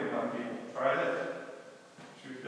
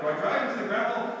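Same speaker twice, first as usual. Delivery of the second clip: usual speaking voice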